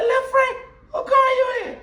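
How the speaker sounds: very high mock mom voice shouting; angry mom. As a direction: play the suspicious mother from the doorway. Mother voiced in story.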